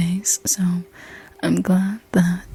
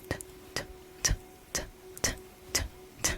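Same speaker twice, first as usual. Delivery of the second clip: tiny, soft, evenly spaced tongue ticks; imitated crab clicks; 16 dB quieter, much faster than usual. Imitating the little crab by the face, soft clicks. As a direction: tick softly like a little crab.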